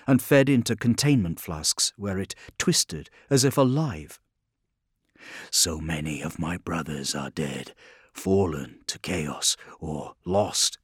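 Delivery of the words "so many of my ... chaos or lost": low, quiet brooding thought; low, soft inner voice. Acted. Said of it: "thought Guilliman".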